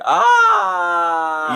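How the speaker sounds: piercing high death cry; death scream. Retold or imitated. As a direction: shriek like a dying hero. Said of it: "he's got his death scream".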